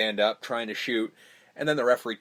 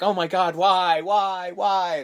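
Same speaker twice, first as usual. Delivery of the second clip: mock despair; 6 semitones higher, much slower than usual. Retold panicked grief.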